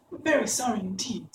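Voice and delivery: put-on high girlish voice, a touch of fright; frightened girl. A narrator's reading. Dorothy apologises to the melting witch, mildly frightened. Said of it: "who was truly frightened".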